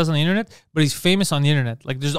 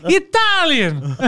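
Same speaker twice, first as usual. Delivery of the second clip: mock Italian; 14 semitones higher, 4 dB louder, much slower than usual. Italian bit.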